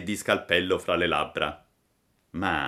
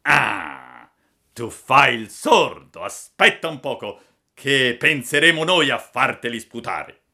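menacing robber; 6 dB louder than usual. Acted. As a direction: threaten him with what's coming. Robber threatens the puppet.

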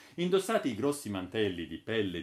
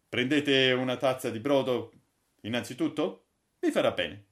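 louder, brisk guide voice; lightly voiced Holker; 5 semitones higher, 7 dB louder than usual. Holker inviting Braddock to try the broth, louder. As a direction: offer the broth like a proud host.

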